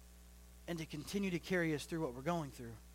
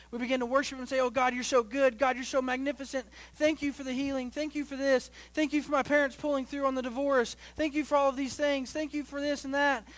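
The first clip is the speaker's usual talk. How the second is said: raised, rolling preacher's cadence; impassioned preaching; 5 semitones higher, 6 dB louder than usual. Acted. Pastor showing worship turning to worry, impassioned preaching. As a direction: pile up the phrases with fervor.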